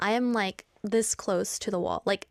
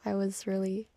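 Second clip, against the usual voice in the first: ordinary delivery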